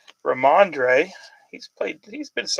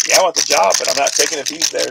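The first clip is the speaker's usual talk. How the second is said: louder, emphatic job demand; imitating a firm demand; 9 dB louder than usual. Voicing the coach candidate refusing the GM, louder and firm. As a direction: lay down the condition with force. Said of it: "Liam Cohen's like".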